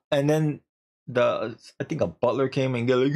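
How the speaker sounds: ordinary delivery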